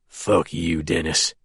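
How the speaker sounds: low, soft menacing growl. A narrator's reading. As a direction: spit it out low under the breath. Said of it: "Snake growls".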